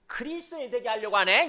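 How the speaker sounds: acted scoffing king; mock insult. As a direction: toss it back as a taunt. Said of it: "이 욕이에요".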